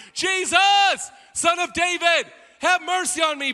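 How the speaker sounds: high, loud desperate scream; screaming plea. Acted. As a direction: shout over everyone around you. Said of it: "screams from the top of his lungs".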